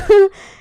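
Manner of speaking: a sudden loud, high burst of laughter. Real, not acted. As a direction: laugh hard at herself. Laughing at own crying story.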